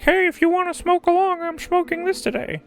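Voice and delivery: high silly voice. Retold or imitated. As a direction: sing it out like a cheerful invite. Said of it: "I will be like".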